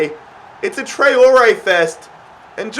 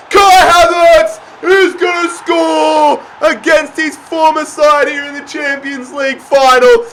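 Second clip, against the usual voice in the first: rising goal call; 4 semitones higher than usual. Real, not acted. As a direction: call the goal like a commentator, climbing into a yell. Goal commentary builds to shout.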